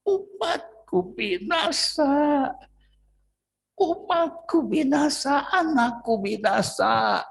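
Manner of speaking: high, slow, grieving cry of God; slow high lament for his people. Acted. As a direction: mourn it slowly, high and aching. Preacher voicing God's lament.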